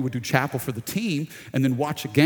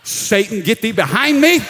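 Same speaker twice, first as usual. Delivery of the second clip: louder and higher, a hammed-up command; mock rebuke of Satan; 7 semitones higher, 7 dB louder than usual. Pastor telling the congregation how he rebuked Satan, hammed-up loud command. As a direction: banish Satan with comic over-earnest force. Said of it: "And I'm like".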